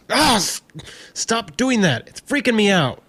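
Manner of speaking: high, loud and frightened; panicked. Acted. Siggy begging the unseen intruder to stop, panicked. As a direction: plead in rising panic.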